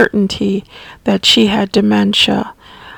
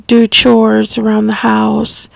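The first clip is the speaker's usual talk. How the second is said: ordinary talk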